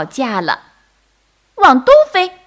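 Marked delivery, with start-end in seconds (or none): 1.6-2.3 very high, loud bird shout; insistent little bird in an argument; quarrelling turtledove. A narrator's reading. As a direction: yell it out in a squeaky bird voice. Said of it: "斑鸠说".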